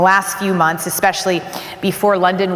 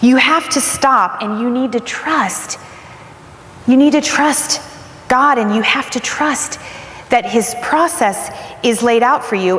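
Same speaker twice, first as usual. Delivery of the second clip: firm sister; 5 semitones higher, slower than usual. Retold or imitated. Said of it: "she just said to me".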